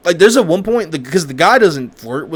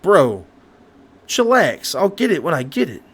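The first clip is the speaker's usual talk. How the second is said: a pitched-up, careless "chillax"; dismissive bro; 4 semitones higher than usual. Retold or imitated. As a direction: brush off the angry friend. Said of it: "And he's like".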